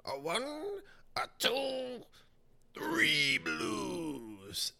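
high, drawn-out cartoonish counting; Tootsie Roll Pop guy. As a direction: count slowly like the lollipop ad character. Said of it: "Tootsie Roll Pop guy".

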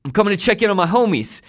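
high, quick imitated announcement; Russ. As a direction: mimic a pal breezing in loudly. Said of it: "And it was Russ coming into".

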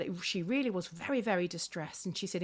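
normal talking voice

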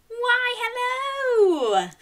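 high, slow, sing-song hello; delighted greeting. Acted. Host greets children shouting their names, delighted.

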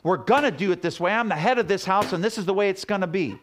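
bossy husband. Retold or imitated. Pastor mocking a domineering husband, loud and bossy. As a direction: lay down the law as the self-important husband. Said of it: "for it is not self-seeking".

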